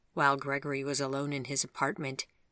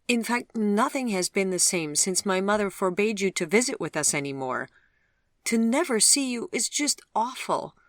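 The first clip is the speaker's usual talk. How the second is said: higher, louder anguished plea; crying out; 8 semitones higher, 7 dB louder than usual. Acted. Vivian crying to Gregory that she can never see him, high and loud. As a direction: cry it out to him in despair. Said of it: "she cried".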